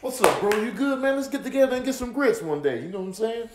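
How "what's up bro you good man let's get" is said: shouted greeting. Acted. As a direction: hail him from afar.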